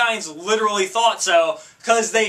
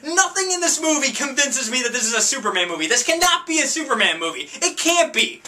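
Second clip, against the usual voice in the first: high-pitched, exasperated comic outrage; incredulous rant; 8 semitones higher than usual. Acted. Incredulous rant at the film's opening.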